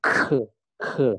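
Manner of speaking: "Ke, ke" with breath puffed after each k; aspirated k. Shown as a demonstration. Teacher demonstrates the air in K, puffed "Ke, ke". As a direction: blow air through the k of "Ke". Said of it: "Ada udaranya ya".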